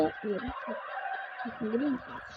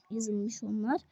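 ordinary delivery

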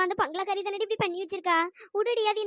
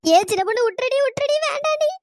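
rising, high-pitched frantic begging; panicked pleading; 6 semitones higher, 5 dB louder than usual. Acted. Begging friend not to twist the neck, panicked. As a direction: plead in mounting panic to be let go.

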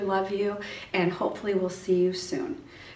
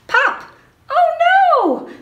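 much higher, louder playful alarm; mock surprise; 13 dB louder, much slower than usual. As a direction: gasp it out for the kids, big and high. Button pops off in story.

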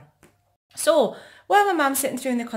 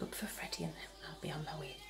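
whispering; 5 semitones lower, 18 dB quieter than usual. Real, not acted. Quietly sneaking away.

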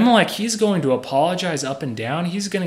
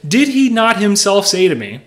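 acted protest, higher and louder; indignant protest; 6 semitones higher, 7 dB louder than usual. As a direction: challenge God as the wrongly accused king. Acting Abimelech's protest to God.

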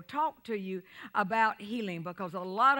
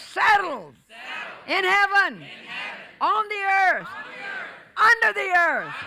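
much higher, much louder, slow, spaced shouts; preaching at full force; 10 semitones higher, 9 dB louder than usual. Real, not acted. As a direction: thunder each phrase to the church.

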